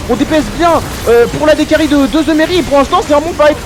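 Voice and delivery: fast high hype casting; esports caster. Real, not acted. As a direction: shout the fight as it unfolds. Live teamfight play-by-play.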